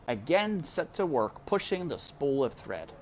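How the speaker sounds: usual speaking voice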